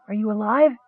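high, trembling plea on the edge of tears; a frightened kender close to crying; voice almost breaking. A narrator's reading. Kender pleading over his wounded friend, voice breaking. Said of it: "his voice almost breaking".

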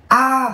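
high, sharp cry of pain; pained scream. Acted. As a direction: scream as bones snap. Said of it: "điên cuồng gào thét".

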